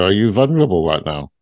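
ordinary delivery

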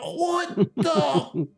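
high falsetto cry fading to a weak squeak; mock shocked wail. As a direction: act out shock, voice dying away as you collapse. Stabbed gunman reacts in shock.

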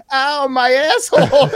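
high-pitched comic yelp of pain; mock pain. Imitating her coming out of the water, mock pain. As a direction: imitate a pained cry, played for laughs. Imitates character surfacing after cliff jump.

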